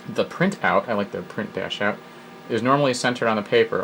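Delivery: normal talking voice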